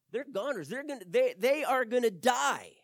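the servant sure they are doomed; panic. Acted.

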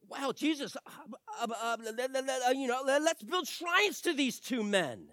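high, eager, tripping over the words; an excited disciple stumbling over his idea; stammering Peter. Retold or imitated.

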